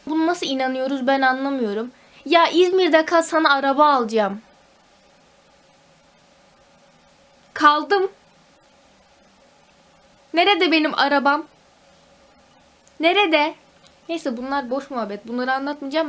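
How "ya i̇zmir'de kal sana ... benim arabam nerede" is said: louder, higher mock-outraged grumble; indignant complaint. Real, not acted. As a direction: demand the car you were promised. Complaining about a promised car. (öfkeli sitem)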